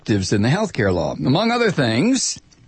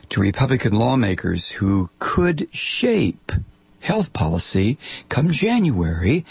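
normal talking voice

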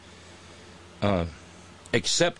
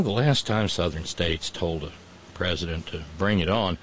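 usual speaking voice

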